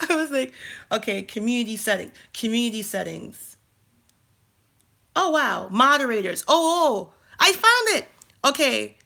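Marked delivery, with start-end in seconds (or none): 5.1-9.0 high, loud, thrilled exclamations; light up when you finally find it; real excited squeal; excited relief. Real, not acted. Finally finds the setting.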